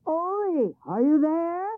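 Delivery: loud high call across; shouting across. A narrator's reading. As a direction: call out to the neighbouring farm. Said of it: "shouted across to Ole's farm".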